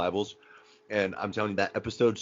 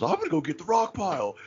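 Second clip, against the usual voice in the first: high mock tough-guy voice, pitched way up; hammed-up wannabe gangster, much higher; imitating J-Rock; 9 semitones higher than usual. Friend retells J-Rock arriving at the strip club, high mock swagger.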